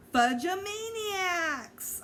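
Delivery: high, slow drawn-out shout; joking cry. A narrator's reading. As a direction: stretch the pun out gleefully. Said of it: "I added".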